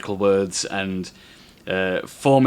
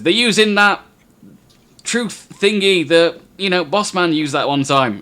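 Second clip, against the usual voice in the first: nervous guard voice; 8 semitones higher, 6 dB louder than usual. Acted. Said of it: "looks at his older colleague and says".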